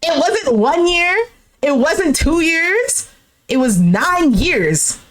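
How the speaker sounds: slow, spaced-out sentences with a pause after each; emphatic scolding. Pretend. Host tells off the ex who won't care, slow and indignant. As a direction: count it out slowly, landing on "nine years". Host lectures him on nine years.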